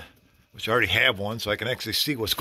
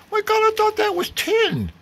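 high, amazed mock visitor voice; imitating a visitor. Visitor mistaking styrofoam for tin, high mock amazement. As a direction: play the astonished guy, pitched way up. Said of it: "Some guy come in and goes".

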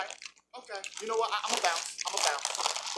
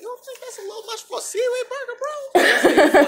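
high, fast, laughing; laughing complaint; 11 semitones higher, 6 dB louder than usual. Acted. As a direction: protest through laughter. Complaining about price, laughing.

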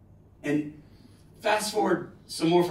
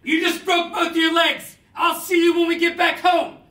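shouted joke; 12 semitones higher, 7 dB louder than usual. Retold or imitated. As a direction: bellow it over the engine. Shouting over helicopter noise.

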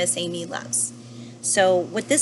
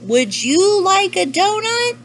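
high, loud, slow call from a boat; yelling down; 11 semitones higher, 7 dB louder, slower than usual. A narrator's reading. Mister Panda calls to the whale from the boat, yelling. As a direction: holler the question to someone far below. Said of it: "Mister Panda yells down from the boat".

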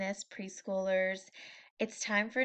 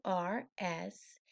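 ordinary delivery, nothing marked

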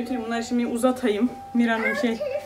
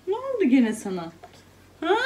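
ordinary delivery

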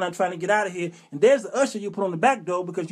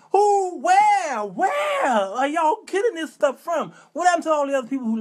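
incredulous outburst; 6 semitones higher than usual. Acted. Churchgoer challenging the dismissal prayer rule, incredulous. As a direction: stretch the question out, pitched way up.

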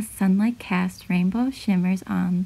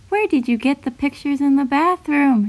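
raised, higher storybook call; mild yell; 5 semitones higher, 4 dB louder than usual. A narrator's reading. Girl calling down to her mom in the kitchen, mild yell. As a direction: call out lightly, pitched up. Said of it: "she yelled down to her mom".

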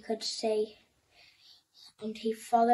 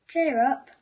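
loud, higher call; 4 semitones higher, 8 dB louder than usual. A narrator's reading. Crowd calling to the blind man, loud higher call. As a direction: call across to him, loud. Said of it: "they called out to the blind man".

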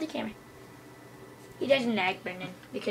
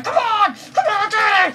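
higher, louder urgent shout; loud high call; 9 semitones higher, 10 dB louder than usual. Real, not acted. Player shouting for his creeper to follow, loud and high. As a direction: yell for him across the cave.

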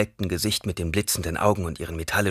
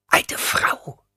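offended hissing repeat; hissed echo; 5 dB louder than usual. A narrator's reading. Old woman hissing back at being called old, offended. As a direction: hiss the words back at him. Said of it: "zischte sie".

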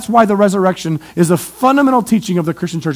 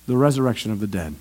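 soft echo; 10 semitones lower, 8 dB quieter, faster than usual. Real, not acted.

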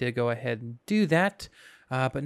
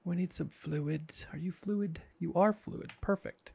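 soft whisper to the machine; mock secretive whisper; 2 semitones higher, 7 dB quieter than usual. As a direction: hush it, as if sharing a secret.

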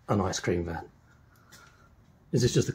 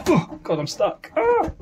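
rising into a sharp high yelp of alarm; genuinely stuck, voice jumps up to a shriek; real stuck panic; slower than usual. Real, not acted. Stuck inside tiny van.

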